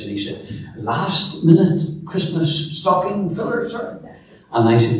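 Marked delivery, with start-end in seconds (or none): 0.8-4.0 posh English shopkeeper voice, higher; a hint of a posh shop assistant. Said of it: "put on this very posh voice".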